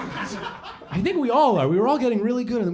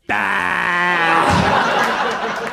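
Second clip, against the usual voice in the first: mock scream; 6 dB louder than usual. Terrified arrival in heaven.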